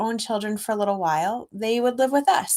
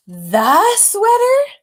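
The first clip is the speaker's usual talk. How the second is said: put-on surprised child; 11 semitones higher, 10 dB louder, much slower than usual. Child character asks, surprised.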